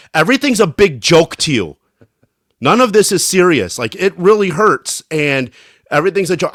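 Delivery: a hammed-up storm-off rant at a laughing friend; mock angry outburst. Retelling how he snapped at his laughing friend, mock angry. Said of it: "I stormed away".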